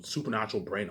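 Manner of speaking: ordinary delivery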